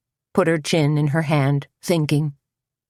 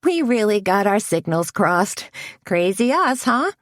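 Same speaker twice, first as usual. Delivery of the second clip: goofy; 6 semitones higher than usual. A narrator's reading. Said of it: "giving a goofy smile".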